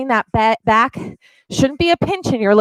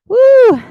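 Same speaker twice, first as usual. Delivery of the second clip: high, loud cheering woo; excited whoop; 13 semitones higher, 7 dB louder than usual. Real, not acted. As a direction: let out a joyful whoop. Cheering mid plank.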